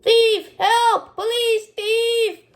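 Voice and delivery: high, loud alarm cries of thief; shouting for help. Acted. A shopkeeper shouts for help at a thief. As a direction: yell it out to the whole market.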